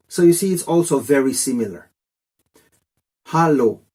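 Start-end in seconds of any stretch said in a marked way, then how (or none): 3.3-3.8 Hallo said as HAH-loh, open a; German Hallo, clear and even. Shown as a demonstration. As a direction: demo the German Hallo, stress first syllable. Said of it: "do the Germans say".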